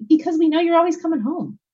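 a hint of a boy consoling his mom; voicing her son.

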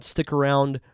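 usual speaking voice

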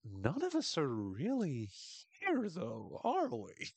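slow, rising high mystic question; spacey Trav. Retold or imitated. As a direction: ask it like a stoned prophet. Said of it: "he's like".

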